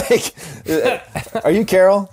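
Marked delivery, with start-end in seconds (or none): 1.4-2.1 "Are you Carol?" higher, pointedly; pointed repeated question. Retold or imitated. Patient hinting to a confused receptionist, "Are you Carol?" said pointedly.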